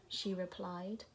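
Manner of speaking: ordinary delivery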